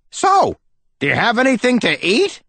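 high, pushy greedy ask; cheeky cousin voice. Acted. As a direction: beg for food with a cheeky tone. Trap's greedy question.

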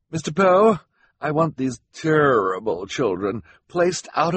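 high, disdainful woman's voice; haughty Esme. Acted. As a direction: complain emphatically, stressing each grievance.

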